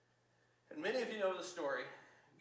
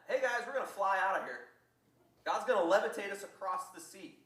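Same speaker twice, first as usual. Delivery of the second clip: loud, raised mock proclamation; hammed-up, loud enthusiasm; excited announcement; 3 semitones higher, 8 dB louder than usual. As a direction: call out a crazy plan to the whole crowd. Acting out a crazy announcement.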